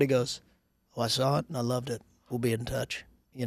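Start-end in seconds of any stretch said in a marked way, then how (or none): 1.0-3.0 light Southern twang, low and soft; a hint of a smooth Southern impression; lightly drawled Southern politician, voice dropping away; Kevin Spacey. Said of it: "the House of Cards version of him".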